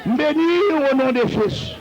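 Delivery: ringing, repeated blessings with long-held cries; rally the church into praise and applause; fervent preaching. Real, not acted. Preaches blessings at full force.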